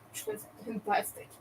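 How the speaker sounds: breathy, high sarcastic whisper-aside. Real, not acted. Streamer to chat about the pranksters, sarcastic whispered aside. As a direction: throw it away, mocking the pranksters.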